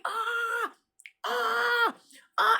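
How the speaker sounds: high, strained cries of pain; retold pained screams; imitated scream. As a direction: mimic a man crying out. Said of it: "And he screamed".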